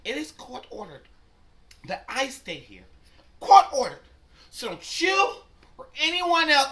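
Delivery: shrill-pitched parody of her insisting; mocking Mary. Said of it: "I'm like, "Mary".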